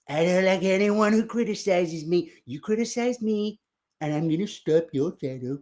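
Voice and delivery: lightly voiced grumbling Trump; a hint of a sulky Trump; mock Trump impression, quietly petulant; Donald Trump. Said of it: "Donald Trump".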